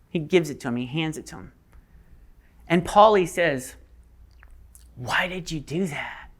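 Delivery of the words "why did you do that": slow, disbelieving whisper; stunned whisper. Acted. Polly confronts Diggory after he gives up apple, stunned whisper.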